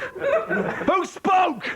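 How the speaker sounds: high-pitched laughing repeat of Who spoke; mock shocked squeak. Host teasing the audience member in a high laughing squeak.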